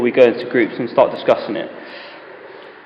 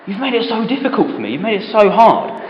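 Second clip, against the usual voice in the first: a touch of whining, fast; mock complaint to God, lightly voiced; complaining Cain; 6 semitones higher than usual. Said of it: "Cain complains".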